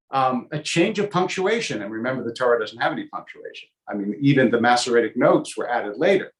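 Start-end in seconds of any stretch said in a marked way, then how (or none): none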